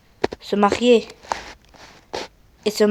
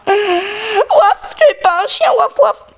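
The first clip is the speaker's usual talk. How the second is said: dog barking voice; 8 semitones higher, slower than usual. Pretend. Kid barking as dog character.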